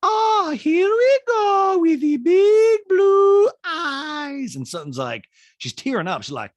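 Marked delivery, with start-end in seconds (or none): 0.0-4.6 very high, loud, dragged-out mocking; hammed-up Bosnian send-up, way up high; Diana. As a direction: jeer slowly in a high cartoonish put-on voice. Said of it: "And Diana's like".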